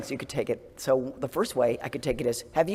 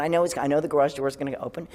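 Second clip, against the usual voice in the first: ordinary talk, nothing marked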